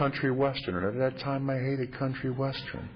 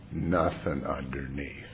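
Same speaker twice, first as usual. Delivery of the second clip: ordinary talk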